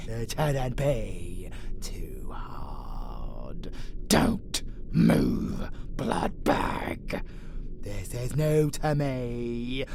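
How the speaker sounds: ordinary delivery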